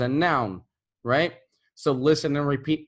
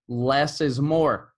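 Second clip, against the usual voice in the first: less is more, slowly, each word weighted; slow emphatic; slower than usual. Shown as a demonstration. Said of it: "I always say this".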